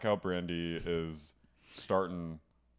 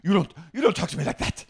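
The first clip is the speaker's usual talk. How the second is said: high, loud indignant scolding; hammed-up outraged housewife; imitating Vicky shrieking back; mock Vicky; 11 semitones higher, 9 dB louder, faster than usual. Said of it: "Vicky goes".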